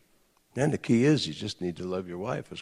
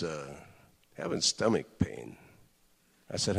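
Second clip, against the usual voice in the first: normal talking voice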